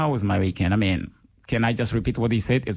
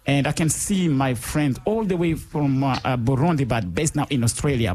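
normal talking voice

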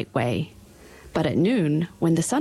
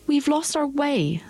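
put-on high, slow lost girl; a touch of a worried little girl; lightly voiced young girl; 9 semitones higher than usual. Dorothy character voice.

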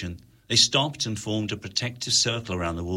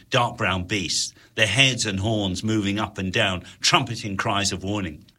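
ordinary delivery, nothing marked

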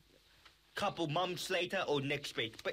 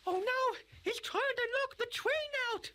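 panicked high gasp. Acted. Poppy panics, train attacked.

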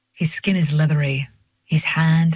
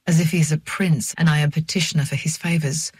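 plain reading voice

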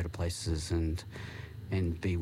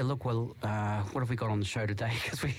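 usual speaking voice

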